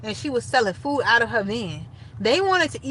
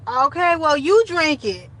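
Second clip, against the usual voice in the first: blunt retort; 6 semitones higher, 5 dB louder, slower than usual. Retold or imitated. Retelling a blunt drunk comeback.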